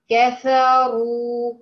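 كفروا with a full, heavy rolled R, drawn out slowly; تفخيم الراء. Shown as a demonstration. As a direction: stretch كفروا and thicken the R. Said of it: "ارتفع بأقصى اللسان". (نطق كفروا براء مفخمة ممدودة)

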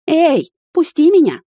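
high, loud, drawn-out shriek of protest; squeal. A narrator's reading. Woman slung over his shoulder demanding release, squealing. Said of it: "взвизгнула я".

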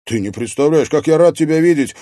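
bursting with joy at seeing her; excited. A narrator's reading. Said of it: "взволнованно проговорил юноша".

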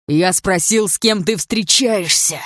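loud, drawn-out furious demand; angry hiss. A narrator's reading. Andrei repeating his question to Dana, loud angry hiss. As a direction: force each word out in anger. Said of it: "прошипел он".

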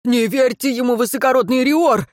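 high, urgent warning; alarmed exclamation. A narrator's reading. Servant warns his lord, alarmed exclamation. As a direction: cry out a warning. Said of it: "воскликнул Гирен".

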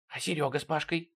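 soft whispered question; secretive hush. A narrator's reading. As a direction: ask it in a whisper. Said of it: "по-прежнему не повышая голоса".

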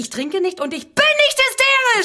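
high, loud shrieking denial; hysterical denial. Acted. Woman shrieks at her accuser that she is not drunk. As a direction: scream it back at the accuser. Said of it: "sie ist hysterisch".

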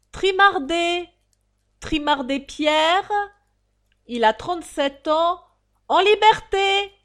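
high, loud, drawn-out crier's call; town-crier bark with stretched vowels; drawling roll-call shout. Acted. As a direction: bellow each name slowly to a crowd. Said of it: "Le détenu aboyeur appela".

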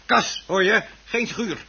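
hoog en luid uitgeroepen; boze correctie. Acted. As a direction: schreeuw de verbetering eruit. Man verbetert haar woedend. (man verbetert zijn vrouw boos en hoog)

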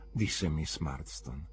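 ordinary delivery

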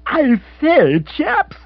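put-on high, excited young toff; a flustered upper-class chap breaking news; Rory's voice. Rory bursts in on his family, high and excited. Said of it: "said Rory".

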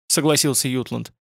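ordinary delivery, nothing marked

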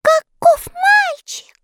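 very high, slow, loud elf chorus; tiny elves gasping in wonder; amazed elves. A narrator's reading. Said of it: "вырвалось у всех эльфов". (восхищённые эльфы, очень высоко и громко)

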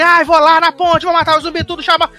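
hammed-up imitation of a heroic wife; mock Michonne. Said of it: "aí Michonne".

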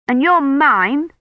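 high, slow retort; stubborn. A narrator's reading. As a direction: dig in, stretching the words. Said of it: "Colin said stubbornly".

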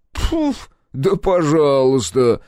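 high puff, then loud drawn-out retort; loud, slow, offended consent; huffy snort. A narrator's reading. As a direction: snort and agree in a huff. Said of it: "фыркнул приятель".

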